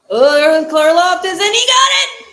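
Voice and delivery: rising, shouting with thrill at the play; excited shout. Real, not acted. Fan watching the game, thrilled shout. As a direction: yell it out as the play breaks open.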